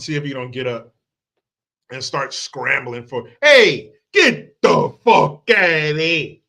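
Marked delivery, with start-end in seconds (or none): 3.4-6.4 slow, loud yell, every word stretched. Acted. Walking into a room to scare people off, yelled. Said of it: "yell those words out".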